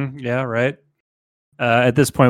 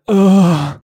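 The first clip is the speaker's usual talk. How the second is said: loud, higher "Ugh" moan; demo moan; 6 semitones higher, 6 dB louder than usual. Shown as a demonstration. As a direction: show a bitten man's moan, up and loud. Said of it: "I was thinking more of like".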